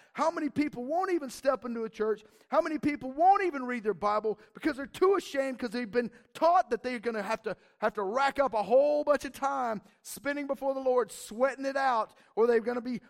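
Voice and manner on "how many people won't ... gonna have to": normal talking voice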